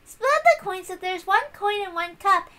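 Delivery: put-on high, bright-pitched, loud know-it-all girl; Erica's voice.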